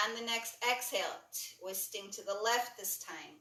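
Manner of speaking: calm yoga instructor leading a class; guided yoga cueing. Acted. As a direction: talk them through each stretch gently. Guides a breathing yoga sequence.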